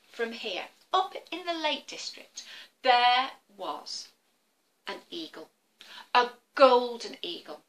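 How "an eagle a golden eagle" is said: usual speaking voice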